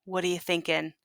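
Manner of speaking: ordinary delivery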